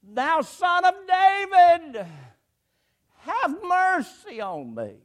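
high, loud, drawn-out plea. Acted. Told to hush, beggar calls to Jesus, crying out. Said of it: "he cried the more a great deal".

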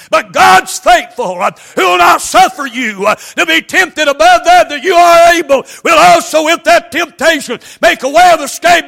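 high, loud, shouted sermon cadence; full-force preaching. Acted. Preacher to congregation, loud shouted preaching. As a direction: drive the scripture home with everything you've got.

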